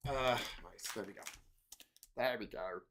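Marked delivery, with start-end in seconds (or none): none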